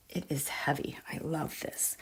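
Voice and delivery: tender whisper over the medal. Real, not acted. Holding her grandfather's war medal, whispering fondly. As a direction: hush down to a whisper.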